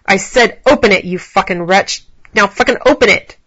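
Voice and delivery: loud, forceful command; angry narrated yell, slowing midway; yelled order. A narrator's reading. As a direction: raise your voice and demand obedience. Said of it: "Alex yelled".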